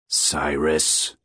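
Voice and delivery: low, muffled-sounding man on the phone; low, thick-breathed man. A narrator's reading. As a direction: speak low, as if under a blanket. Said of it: "The man's voice was low, his breath thick".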